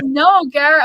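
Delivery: loud, high impatient no; annoyed reply to stepfather; exasperated. Retold or imitated. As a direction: cut him off, fed up. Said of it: "I'm like".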